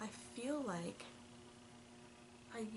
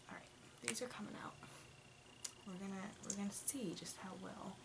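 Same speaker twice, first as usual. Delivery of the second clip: whispering; 9 dB quieter than usual. Real, not acted. Whispering so family sleeps.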